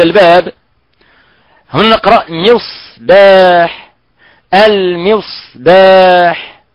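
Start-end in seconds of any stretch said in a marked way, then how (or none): 1.7-6.6 المصباح drawn out slowly, clear س; teacher stretches المصباح slowly for the class; slow careful demo. Shown as a demonstration. Teacher modeling المصباح for students, slow careful demo. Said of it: "لا نقول المصباح".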